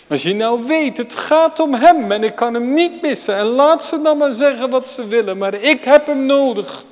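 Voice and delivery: high, loud, urgent preaching; fervent preaching cry. Acted. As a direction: cry it out as one who can't miss him. Preacher voicing Bartimaeus' need.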